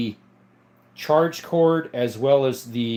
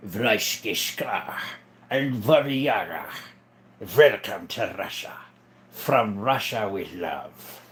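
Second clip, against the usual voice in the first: low, slow mock Russian voice; attempted Russian accent; 4 semitones lower, slower than usual. Livestreamer trying out Russian for viewers, mock Russian accent. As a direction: put on a rough Russian accent. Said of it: "Trying to remember what Russian sounds like".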